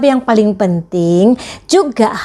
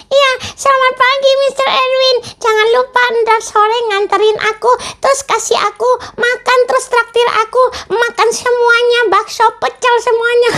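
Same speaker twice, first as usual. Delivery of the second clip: put-on much higher childlike puppet voice; puppet friend; 13 semitones higher than usual. Teacher voicing her puppet for the children, high puppet voice. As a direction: play the cheeky puppet sidekick, pitched way up. Puppet asks principal for treats.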